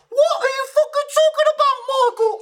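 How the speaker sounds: extremely high, squawking disbelief; Gavin. Acted. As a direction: screech it in falsetto character voice. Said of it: "lot easier than me just yelling".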